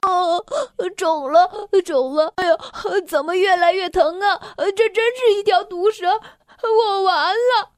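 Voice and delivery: high, tearful groaning, slowing at the end; moaning in pain. A narrator's reading. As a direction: moan through the pain, sure he's doomed. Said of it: "咬着牙呻吟道".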